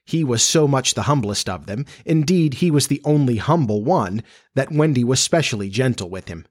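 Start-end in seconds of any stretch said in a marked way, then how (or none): none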